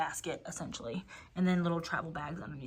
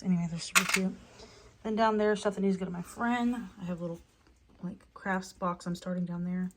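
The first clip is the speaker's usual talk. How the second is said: ordinary talk, nothing marked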